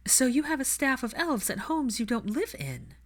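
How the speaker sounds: a hint of a questioning young heroine. A narrator's reading. Narrator voices the heroine.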